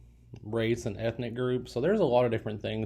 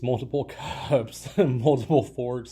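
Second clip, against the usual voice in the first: laughing; 2 semitones higher, 6 dB louder, slower than usual. Real, not acted. Amused listing of table settings.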